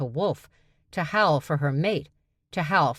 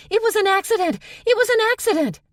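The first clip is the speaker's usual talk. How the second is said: high, loud, shrieking yell; panicked yelling; 7 dB louder, faster than usual. A narrator's reading. Stevie fleeing her sister, yelling high and loud. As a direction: shriek it while running away.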